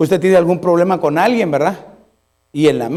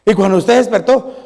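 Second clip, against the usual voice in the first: usual speaking voice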